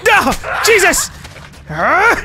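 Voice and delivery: high startled yelling; startled shout. Real, not acted. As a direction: caught off guard, yelp. Startled by enemy in game.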